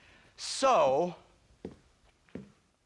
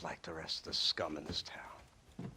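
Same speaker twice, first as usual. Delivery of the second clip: whispered sneer; 8 semitones lower, 9 dB quieter than usual. Acted. Sneering insult to Marston.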